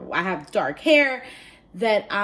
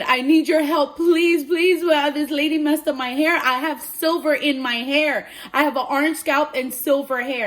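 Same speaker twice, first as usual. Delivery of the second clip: loud, raised, hammed-up begging; distraught plea; 6 semitones higher, 6 dB louder than usual. Niece phones aunt over ruined hair, distraught plea. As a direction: retell a desperate call for help. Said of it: "I was literally screaming in tears".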